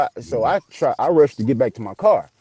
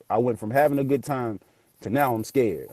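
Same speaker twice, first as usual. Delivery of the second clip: normal talking voice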